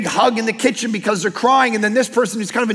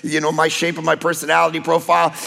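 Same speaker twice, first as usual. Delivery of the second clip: lower, lazy entitled whine; lazy millennial; 6 semitones lower than usual. Retold or imitated. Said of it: "the stereotype of millennials is they sit around and say".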